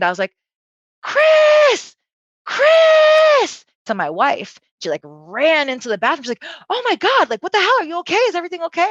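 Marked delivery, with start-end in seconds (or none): 1.0-3.6 piercing, high-pitched re-enacted scream. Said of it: "I just screamed". 6.7-8.9 high-pitched, panicky questions; alarmed wife. Retold or imitated. Acts wife rushing in alarmed.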